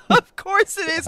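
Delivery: high, loud laughing reply; amused outburst. Real, not acted. Co-host answering whether the film is brutal, laughing loudly. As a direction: burst out with it through laughter.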